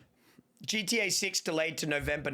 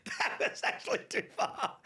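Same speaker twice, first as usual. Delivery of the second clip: laughing; 7 semitones higher than usual. Real, not acted. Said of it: "I got chills".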